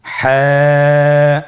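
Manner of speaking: হ্যাঁ held long, pressed from mid throat; throat-squeezed Ha. Shown as a demonstration. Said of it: "আপনারা আমার সাথে পড়ুন". (গলা চিপে টেনে হ্যাঁ)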